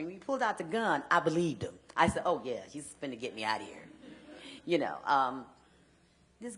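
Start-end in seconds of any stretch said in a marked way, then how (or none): 2.3-3.9 relieved inner voice. Retold or imitated. Her own retold thought.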